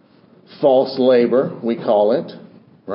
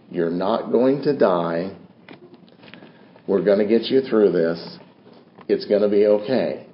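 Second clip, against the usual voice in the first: reassuring; 5 semitones lower, 4 dB quieter than usual. Retold or imitated. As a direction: soothe her like a nurse at the bedside. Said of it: "spoke to her very reassuring".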